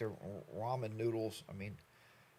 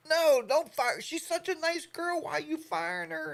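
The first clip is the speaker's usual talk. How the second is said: much higher, louder whiny protest at the TV; upset pleading; 5 dB louder than usual. Retold or imitated. Yelling at the TV about a fired contestant, high whiny protest. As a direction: beg the show not to fire her. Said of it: "And I'm like".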